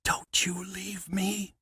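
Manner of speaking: breathless slow whisper; a girl whispering to her dying love. Acted. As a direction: whisper it close to his face. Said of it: "she whispered".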